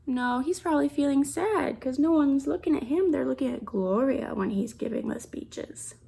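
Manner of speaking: plain reading voice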